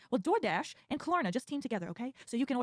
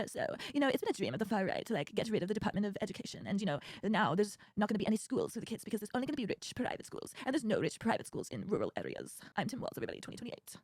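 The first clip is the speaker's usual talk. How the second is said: softer, folksy lower voice; a soft, lightly voiced Midwestern governor; Tim Walz impression; 4 semitones lower, 6 dB quieter than usual. Requested impression for fans, soft folksy Walz. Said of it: "this is Tim Walz's voice now".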